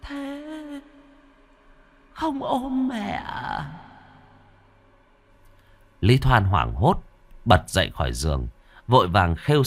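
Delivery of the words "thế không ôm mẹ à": high, soft, slow ghost voice; a dead woman's chilling plea; ghostly whisper. Acted. Ghost of mụ Hướng calling.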